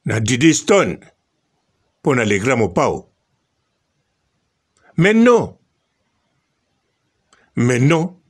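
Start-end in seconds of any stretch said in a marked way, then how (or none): 5.0-5.6 high, loud outburst of disbelief; indignant exclamation. Real, not acted. Vlogger rejecting the rumour to viewers, indignant high outburst. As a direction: throw it up high like you can't believe it.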